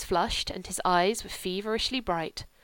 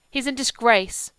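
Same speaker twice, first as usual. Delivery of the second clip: put-on a child's clear, louder, higher line, tolling like a bell; lightly voiced child delivering grave news; 5 semitones higher, 8 dB louder than usual. A narrator's reading. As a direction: let it ring out like a bell tolling. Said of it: "in that clear child's voice had the ring of a bell tolling".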